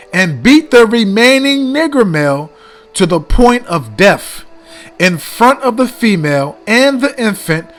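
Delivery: dramatic reading aloud. Shown as a demonstration. Reads the letter aloud.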